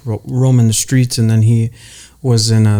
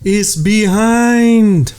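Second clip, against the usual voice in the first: goofy drawn-out voice; 9 semitones higher, much slower than usual. Pretend. Catchphrase repeated, stretched.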